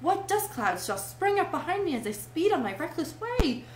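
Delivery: grandiose rapture. A narrator's reading. Toad dreams of flinging carts in ditches, grandiose rapture. Toad rhapsodizes over his motoring future.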